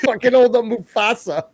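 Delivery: cackling shouted quip; laughing shout. Real, not acted. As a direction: yell it mid-laugh. Laughing shout during shared joke.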